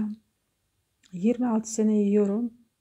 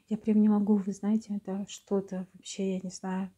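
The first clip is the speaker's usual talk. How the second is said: ordinary talk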